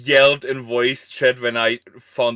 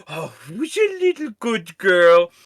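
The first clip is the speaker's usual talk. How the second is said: higher cooing pet voice; teasing baby talk; 7 semitones higher, 3 dB louder than usual. Retold or imitated. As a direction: coo it like talking to a puppy. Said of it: "is now teasing M with".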